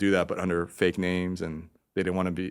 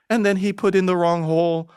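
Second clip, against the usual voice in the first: mock fantasy narrator; 9 semitones higher, 4 dB louder than usual. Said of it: "ridiculous male fantasy".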